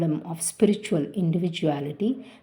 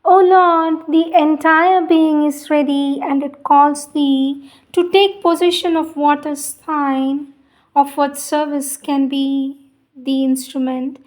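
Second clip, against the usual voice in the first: higher, louder, solemn devotional read; prayer reading; 7 semitones higher, 5 dB louder than usual. Acted. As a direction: offer each line up as a fervent prayer to the Lord.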